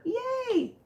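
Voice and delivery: high, drawn-out happy yay; gleeful cheer. Real, not acted. Host celebrates the long-awaited platforms with viewers, gleeful cheer.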